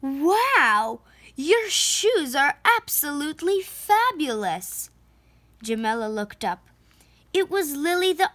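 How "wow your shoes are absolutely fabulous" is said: delighted artist. Acted. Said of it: "someone said".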